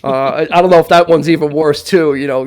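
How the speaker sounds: ordinary delivery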